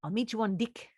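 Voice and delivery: light New Zealand accent, E's to I's. Shown as a demonstration. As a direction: try a Kiwi accent. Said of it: "New Zealand is".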